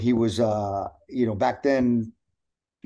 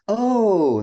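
sudden realization; 9 semitones higher, 5 dB louder than usual. Retold or imitated. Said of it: "And I went".

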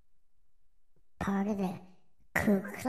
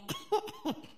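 high strained coughing; smoker's cough; 5 dB quieter than usual. Real, not acted. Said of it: "Give me a second".

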